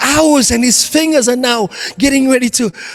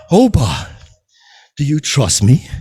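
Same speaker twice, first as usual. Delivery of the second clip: much lower, solemn divine voice; voice of God; 10 semitones lower than usual. Acted. God calling down to Oba, much lower and solemn. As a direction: speak down from the heavens, calm and low. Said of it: "It is God".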